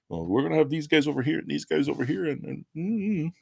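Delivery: lightly mocking high sing-song; quick, high mock of the bosses. Mocking organizers' split plan.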